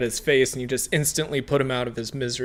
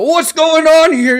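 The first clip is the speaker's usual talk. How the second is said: loud high-pitched bellow; shout; 15 dB louder than usual. Acted. First mate shouting at the party, loud shout. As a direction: yell it out as an alarmed first mate. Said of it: "he shouts out".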